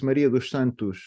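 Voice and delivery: "Maria dos Santos" with dos as "dush" and final o as u; Portuguese "Maria dos Santos", o like u, s like sh; Portuguese name. Shown as a demonstration. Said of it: "the O in the end of the words ... sounds like U".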